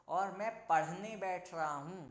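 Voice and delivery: पढ़ने said slowly and loudly with a breathy ढ़; slow teaching demo. Shown as a demonstration. Teacher stretching पढ़ने for students, slow and loud. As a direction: stretch out पढ़ने so the aspiration is heard. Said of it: "ढा के नीचे बिंदी आएगी".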